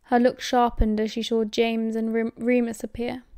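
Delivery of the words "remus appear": plain reading voice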